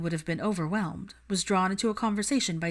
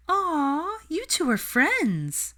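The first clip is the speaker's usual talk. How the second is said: high, slow, dreamy coo; airy Luna; 11 semitones higher, 3 dB louder, much slower than usual. Acted. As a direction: float the words out sweetly. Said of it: "announced with an airy smile".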